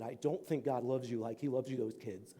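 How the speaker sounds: quiet, gentle earnestness; tender reassurance. Acted. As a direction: comfort a child very softly. Lady tenderly reassures the boy.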